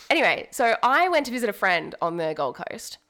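ordinary talk

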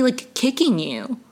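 ordinary delivery

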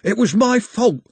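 high, pleading protest; whiny protest. Acted. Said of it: "Carter protested".

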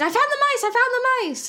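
very high-pitched mock shriek; imitated scream. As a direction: scream it in mock alarm, voice shooting way up. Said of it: "and she screams".

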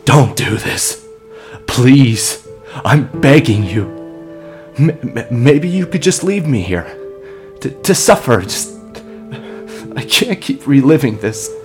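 desperate begging. Acted. Man begs for mercy.

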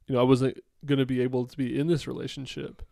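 ordinary talk, nothing marked

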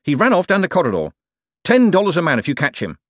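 high, loud urgent order; shouted. A narrator's reading. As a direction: send the men running after him. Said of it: "shouted the man in the dressing gown".

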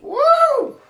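loud, high-pitched "woo"; sudden excited woo; high whoop. Real, not acted. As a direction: let out a big high whoop.

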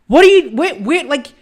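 high, loud, incredulous rant; outraged disbelief. Real, not acted. Podcaster reacting to an article, loud outrage. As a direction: blow up in disbelief.

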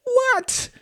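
very high incredulous cry; cried out. A narrator's reading. Husband reacts to his wife's accusation, crying out. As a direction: yelp it in disbelief. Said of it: "he cried".

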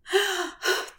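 high, airy gasp; mock swooning gasp. Imagined swoon at his voice.

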